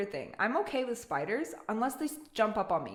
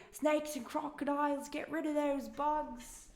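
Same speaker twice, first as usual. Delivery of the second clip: a higher, slowed mock of the viewer's voice; reading in the viewer's voice; 5 semitones higher than usual. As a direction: read it back the way the viewer would say it. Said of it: "I'm reading it in your voice too".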